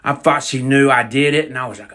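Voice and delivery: usual speaking voice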